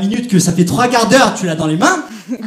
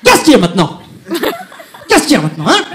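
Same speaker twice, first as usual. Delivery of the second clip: aggressive thug challenge; 8 semitones higher, 7 dB louder, faster than usual. Acted. Acting the aggressive guy on train.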